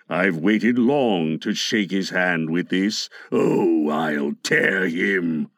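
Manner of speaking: very low, menacing pirate; threatening Captain Hook. A narrator's reading. Hook vows revenge on Peter, low menace. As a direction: threaten deep in the voice, dragging the end. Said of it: "He brandished the hook threateningly".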